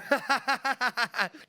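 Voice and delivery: high, forced run of ha-ha-has; mock laughing along with nothing to say; awkward retold laugh. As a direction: imitate a nervous filler laugh. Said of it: "I started just laughing and going".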